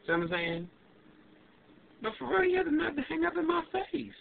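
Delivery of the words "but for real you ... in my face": much higher, indignant pitch; high incredulous complaint; outraged. Real, not acted. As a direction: squeak with disbelief. Outraged about hang-up.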